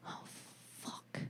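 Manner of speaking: mock-stunned near-whisper; barely voiced, halting aside; hushed dismay. Acts out stunned reply to confession.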